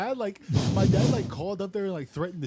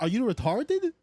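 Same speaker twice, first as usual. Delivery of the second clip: much higher, mock-incredulous boss; incredulous question; 8 semitones higher, slower than usual. Boss insulting worker over stains, imitated incredulity. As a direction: mimic her asking it, pitch climbing at the end. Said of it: "asked me like that".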